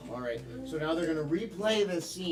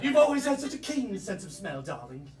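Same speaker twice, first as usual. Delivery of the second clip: much higher posh British lady voice; mock British accent; 4 semitones higher than usual. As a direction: play the mother-in-law in a hammed-up British accent. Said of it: "British, French, and German".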